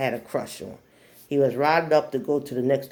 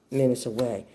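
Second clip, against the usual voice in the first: ordinary delivery, nothing marked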